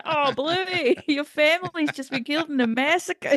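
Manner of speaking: high, cheery mock Australian accent; a hint of an Aussie kids' show voice, played dark; bad Aussie accent. Recalling her improv Bluey bit in a bad Aussie accent. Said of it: "I just went for it with the Aussie accent".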